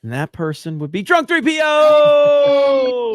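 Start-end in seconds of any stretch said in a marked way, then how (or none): 1.0-3.1 loud, high announcer shout, last syllable stretched out; shouted guest introduction. Real, not acted. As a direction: bellow the name like a ring announcer.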